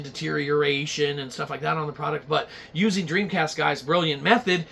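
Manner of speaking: ordinary delivery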